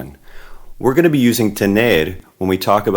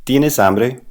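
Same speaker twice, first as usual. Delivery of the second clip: "Tienes hambre" higher, rising at the end; question intonation; 5 semitones higher than usual. Shown as a demonstration. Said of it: "say it like a question".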